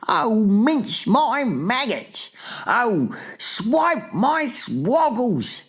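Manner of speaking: high, slow, drawn-out wail; hammed-up giant's horror; dismayed exclaiming. A narrator's reading. Giant dismayed at his catch.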